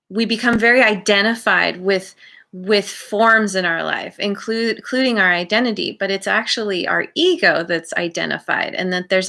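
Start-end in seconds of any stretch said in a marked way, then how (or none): none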